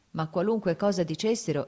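ordinary delivery, nothing marked

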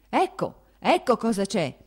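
a high, loud outburst from an angry father; shouting prince. Acted. The prince yelling at his wife, furious shout. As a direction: fling the words out in a rage. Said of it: "gridava il principe".

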